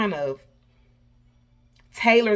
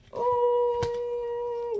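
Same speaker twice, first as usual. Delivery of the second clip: excited ooh. Real, not acted. Card reveal squeal.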